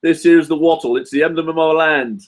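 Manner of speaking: high-pitched mock Australian recital; Australian accent. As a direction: proclaim it in a broad Aussie accent. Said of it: "the Australian accent thing".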